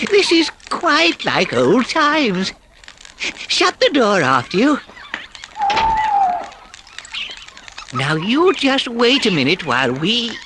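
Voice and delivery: high, slow, happy Mole voice; delighted Mole. A narrator's reading. Mole bustling the field mice in, high and delighted. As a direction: relish the old times. Mole's voice.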